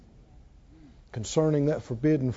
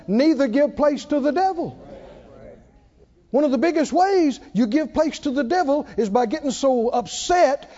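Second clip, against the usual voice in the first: higher, louder preaching cadence; full-force preaching; 6 semitones higher, 3 dB louder than usual. Acted. Pastor urging the congregation about anger, fervent preaching. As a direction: drive the verses home to the crowd.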